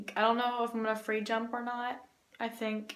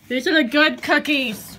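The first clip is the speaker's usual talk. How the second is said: excited; 5 semitones higher, 10 dB louder than usual. Real, not acted. Showing off the cookies.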